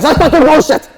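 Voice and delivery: hammed-up furious dad yelling; angry outburst. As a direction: explode in a high, loud mock rage. Retold dad explodes at insult.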